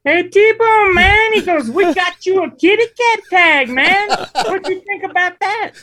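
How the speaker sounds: very high surfer-dude voice, laid back; high-pitched surfer dude. Retold or imitated. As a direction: squeak it out like a mellow surfer guide. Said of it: "he's got a real high-pitched voice".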